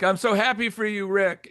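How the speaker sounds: high, loud delighted gush; gushing joy. Retold or imitated. Guitarist congratulating his famous old Rickenbacker, overjoyed. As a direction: beam with pride at the old guitar.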